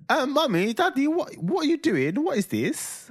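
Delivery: put-on confused child. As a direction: squeak it out, lost and puzzled. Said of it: "Ivor walks in and he's like".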